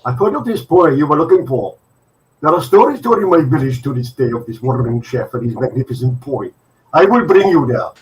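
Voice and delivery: put-on Indian accent. Indian traveller inviting the cook to his village, Indian accent. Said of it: "your Indian accent was the best".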